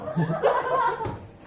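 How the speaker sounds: high burst of laughter; loud laugh. Real, not acted. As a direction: break into laughter. Laughs after the quote.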